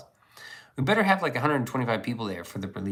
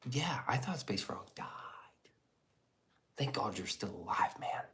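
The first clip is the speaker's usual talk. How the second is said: whisper; 9 dB quieter than usual. Real, not acted. Whispering to chat about Space Frog.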